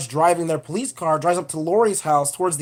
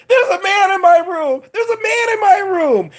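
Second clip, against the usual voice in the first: much higher and louder, hammed-up panic; panicked kid yell; 13 semitones higher, 4 dB louder than usual. Little boy running down the stairs, mock panicked yell. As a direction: shriek it like a scared kid running. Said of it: "the little boy comes barreling down the stairs".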